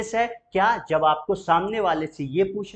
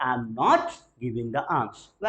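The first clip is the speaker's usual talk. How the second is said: very slow, fading softer; slow soft negative; 5 semitones lower, much slower than usual. Shown as a demonstration. Teacher drills the negative answer, slow and fading. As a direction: draw the not-answer out gently. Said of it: "नो में बोलना हो".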